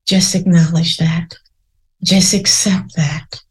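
gentle, unhurried meditation guide; guided meditation. Acted. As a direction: lead listeners inward calmly. Leads a guided reflection.

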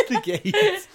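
high giggling repeat; laughing. Real, not acted. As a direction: squeal it laughing.

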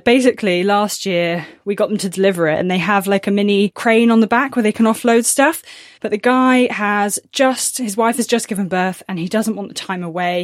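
usual speaking voice

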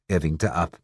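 plain reading voice

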